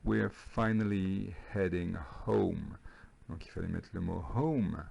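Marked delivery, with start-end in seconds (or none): 0.0-2.8 slow, word-by-word teacher repeat; répétition lente. Shown as a demonstration. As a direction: spell the sentence out slowly for learners. Répète la phrase lentement pour élèves. (répétition lente et posée pour les élèves)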